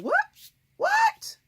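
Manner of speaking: high, loud disbelieving "What? What?"; shocked shriek. Retold or imitated. Retelling the Filipino phone call, a shocked high shriek. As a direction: squeal it in mock disbelief. Retold shock at caller's location.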